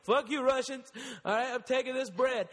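high, cocky dog voice; a bread-stealing dog gloating. Retold or imitated.

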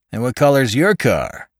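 loud suspicious questioning; suspicious policeman. A narrator's reading. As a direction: press him hard.

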